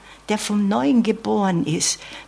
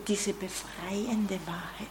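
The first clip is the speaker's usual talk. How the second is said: hushed, slow; 13 dB quieter than usual. Real, not acted. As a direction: say diese befreiende Wahrheit softly and slowly, almost breathed. Same phrase, hushed then loud. (leise und langsam, fast gehaucht)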